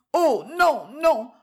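high, quick-reacting young lady; lively protest. A narrator's reading. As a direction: cut in with a high-pitched denial. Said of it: "reprit vivement Rosa".

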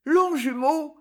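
high, hesitant echo of "Longjumeau"; stammered echo. A narrator's reading. Girl blushing at the town's name, stammering high. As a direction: repeat "Longjumeau" high, wavering upward. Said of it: "balbutie la jeune fille".

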